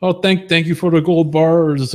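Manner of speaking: mock gushing streamer thank-you, high and loud; high, loud impression of a grateful streamer; Sweatpants Studio. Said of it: "So Sweatpants Studio says".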